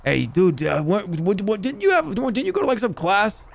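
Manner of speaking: hammed-up puzzled buddy; mock confused friend. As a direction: fumble toward the question, pitch climbing. Acted friend confused by the student.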